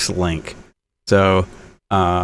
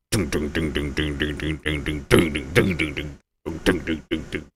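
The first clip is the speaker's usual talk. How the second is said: low soft imitated timpani hits; low quiet timpani imitation; timpani dings; 10 semitones lower, 12 dB quieter than usual. Shown as a demonstration. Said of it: "Like timpanis".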